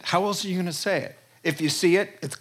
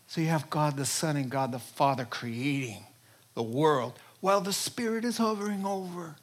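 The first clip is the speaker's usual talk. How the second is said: ordinary talk, nothing marked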